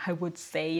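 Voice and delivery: ordinary delivery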